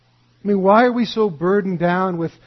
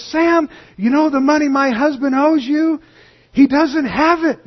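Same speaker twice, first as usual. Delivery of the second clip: high, loud hollered announcement; mock wife shouting; 8 semitones higher, 5 dB louder than usual. Wife calling to the neighbor from the window, high loud voice. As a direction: shout it across to the neighbor, pleased with herself.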